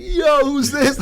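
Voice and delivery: high-pitched mock amazement; retold amazed call. Neighbors spot his new haircut and glasses, mock amazed. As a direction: imitate neighbors calling out in surprise.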